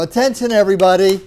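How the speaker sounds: loud announcement. Retold or imitated. Man announcing to strangers in an elevator, loud and high.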